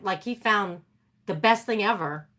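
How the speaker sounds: ordinary talk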